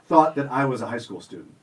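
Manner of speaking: ordinary talk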